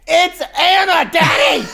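put-on high, slow shrieking little girl; toddler scream. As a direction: scream it like a three-year-old. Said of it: "she only screams".

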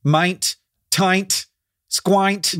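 long i in mint, tint, squint; rhymed with pint. Shown as a demonstration. Host making words rhyme with pint, long i in each. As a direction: say mint, tint, squint as "mynt, tynt, squynt". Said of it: "make them rhyme with pint".

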